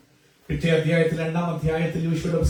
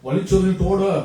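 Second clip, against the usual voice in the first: higher, urgent preaching push; fervent preaching; 6 semitones higher than usual. Acted. Preacher urges listeners to tear free, fervent preaching. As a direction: rise up and urge them to break free. Preaching exhortation at full force.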